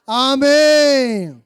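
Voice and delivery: a very slow, long, loud amen falling away; drawn-out amen. Acted. Student ending his exam prayer with a grand amen. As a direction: stretch the amen like a preacher's finale.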